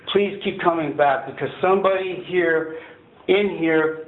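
usual speaking voice

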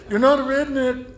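high, loud mock disbelief; retold contradiction. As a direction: deny it as the incredulous friend. Retold back-and-forth quarrel.

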